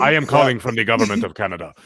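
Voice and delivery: low, put-on scam caller accent. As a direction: play the fake government caller.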